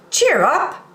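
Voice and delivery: high, bright call of a toy clown; a toy clown crying out to cheer the sad toys; cheerful cry. Acted. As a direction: lift everyone's spirits. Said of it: "cried the little toy clown".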